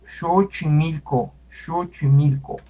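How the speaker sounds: Xochimilco with x as sh, "sho-chi-MIL-co"; correct Nahuatl pronunciation. Shown as a demonstration. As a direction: repeat "Xochimilco" carefully with an opening sh. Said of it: "se debe de pronunciar".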